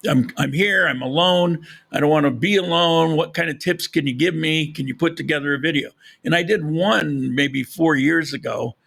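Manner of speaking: ordinary delivery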